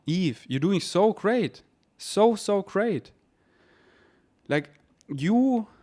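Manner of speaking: slow, drawn-out encouragement; warm praise. Real, not acted. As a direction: linger on each so as if cheering a friend on. Praising listener Eve directly.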